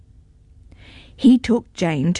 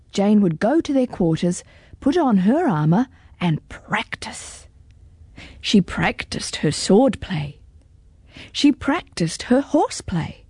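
plain reading voice

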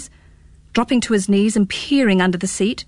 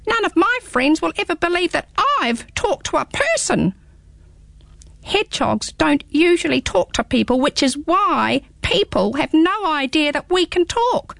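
little hedgehog voice; 7 semitones higher than usual. Acted. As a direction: turn the boy's words back, squeaky. Hedgehog echoes Pins.

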